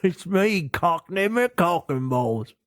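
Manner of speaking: cartoon Cockney showman; mock Cockney. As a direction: proudly overdo a terrible British accent. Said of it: "The most British man of all time".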